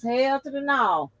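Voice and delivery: hell-to-the-no attitude; sassy refusal. Real, not acted. Refusing the inhaler, exasperated.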